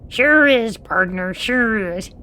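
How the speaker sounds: high Western twang shout; cowboy twang. Acted. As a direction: whoop it like a proud cowpoke. Cowboy voice continues.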